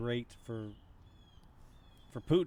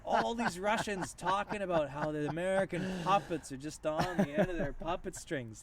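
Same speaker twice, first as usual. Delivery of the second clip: laughing; 6 semitones higher, 5 dB louder than usual. Real, not acted. Laughing at the puppets story.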